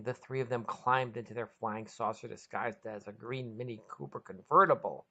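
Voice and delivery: ordinary delivery, nothing marked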